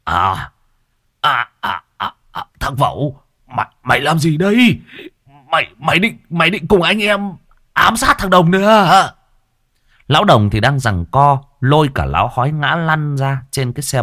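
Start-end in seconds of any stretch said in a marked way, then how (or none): 0.0-9.1 halting, rising alarmed stammer; stammering shock. Acted. Vẩu is confronted in alarm, stammering shocked voice. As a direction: stumble over words in disbelief.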